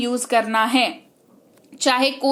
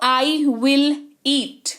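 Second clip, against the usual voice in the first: each word drawn out slowly, will weighted; slow teaching demo stressing will; much slower than usual. Shown as a demonstration. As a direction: spell it out word by word for learners. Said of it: "future में will का इस्तेमाल होता है".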